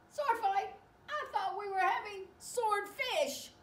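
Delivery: high, loud character voice; loud, high-voiced baffled shark; startled protest. Shark startled by sword fighting.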